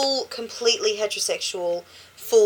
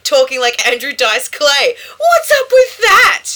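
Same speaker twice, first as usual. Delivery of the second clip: mock outrage; 4 semitones higher, 7 dB louder than usual. Reading listener comment with mock outrage.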